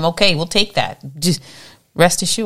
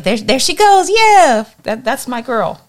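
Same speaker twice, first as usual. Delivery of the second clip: high, loud, thrilled shout; excited cheering; 8 semitones higher, 7 dB louder than usual. Pretend. Jesus spotting her at the finish line, loud and thrilled. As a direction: cheer her on like a proud fan. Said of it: "I want Jesus to be looking for me like".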